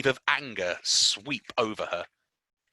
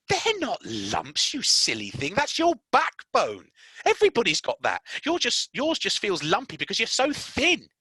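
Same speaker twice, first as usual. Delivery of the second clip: Mary scoffing at his fear; brusque reassurance; 12 semitones higher, 4 dB louder than usual. Acted. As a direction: set him straight.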